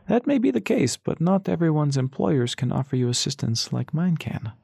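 silky stranger. Acted. Mysterious man's persuasive offer.

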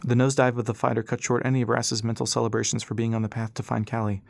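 usual speaking voice

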